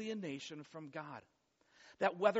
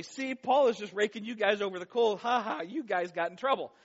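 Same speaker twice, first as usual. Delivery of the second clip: mocking gloat; 3 semitones higher, 7 dB louder than usual. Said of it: "then the Gentiles say".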